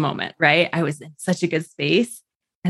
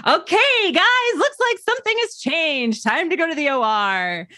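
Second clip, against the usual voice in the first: mock a doctor rallying the room; intrusive doctor impression; 11 semitones higher than usual. Mimics doctor breaking quiet mood.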